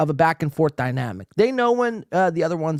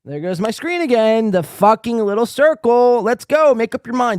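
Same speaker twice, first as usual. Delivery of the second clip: exasperated; 6 semitones higher, 5 dB louder than usual. Real, not acted. Screen freezes again.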